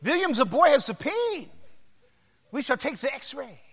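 put-on high-pitched thick German accent; Doctor Hans Schein. German doctor telling the boy's father, high German accent. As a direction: announce it as a comic German doctor. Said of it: "called him, called him William".

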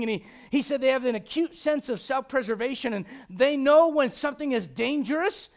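loud, emphatic pulpit delivery; preaching at full force. Acted. Pastor preaching to the church, loud and emphatic. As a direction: drive it home to the congregation.

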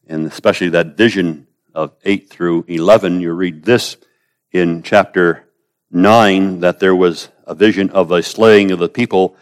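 usual speaking voice

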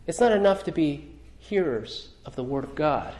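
usual speaking voice

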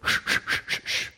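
unvoiced shushing like erasing; eraser rubbing noise. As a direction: scrub the air with shh sounds. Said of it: "take an eraser and go".

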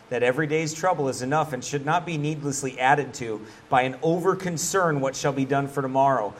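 normal talking voice

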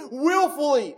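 "Willfully" hit high and loud as a bold word; stress on Willfully. Shown as a demonstration. Preacher reading the verse's first Greek word, "Willfully" punched high and loud. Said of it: "in bold".

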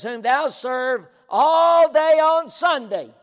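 loud, high, drawn-out mock call. King calling out to Daniel, deliberately misquoted, mock proclamation. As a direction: holler the misquote slowly. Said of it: "the king calls out a compliment".

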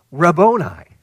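Mary crying Rabboni to Jesus; joyful cry. Retold or imitated. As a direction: burst out Rabboni in recognition.

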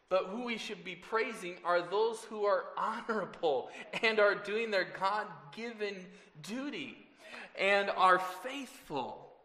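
ordinary delivery

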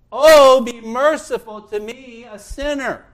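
loud, high plea for mercy; crying out. Acted. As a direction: cry out as a desperate sinner begging God. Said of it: "He cried out and said".

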